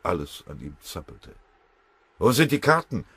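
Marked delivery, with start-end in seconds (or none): 2.2-3.1 laut und höher gebrüllt; Gebrüll. A narrator's reading. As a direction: aufgeregt herausbrüllen. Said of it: "brüllte er". (Fröbe stürmt herein und brüllt nach den Karten, laut)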